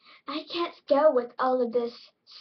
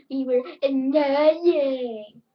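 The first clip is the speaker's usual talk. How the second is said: mock complaint; 7 dB louder, much slower than usual. Retold quarrel, drawn-out complaint.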